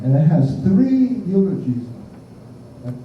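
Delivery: normal talking voice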